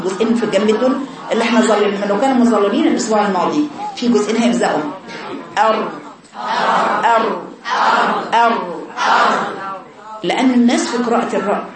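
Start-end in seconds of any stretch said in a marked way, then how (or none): 5.6-9.5 repeated أر with a light trill; teacher demonstrates the letter ر alone; demo r sound. Shown as a demonstration. Teacher showing students the correct أر. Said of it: "وأنا بقرأ أر".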